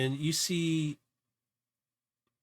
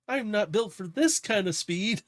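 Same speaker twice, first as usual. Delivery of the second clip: high, strained character voice; acted high-pitched protest; mild panicked yelling; 9 semitones higher, 4 dB louder than usual. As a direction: yell a little as the speed gets too much. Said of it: "you hear yelling and screaming a little bit".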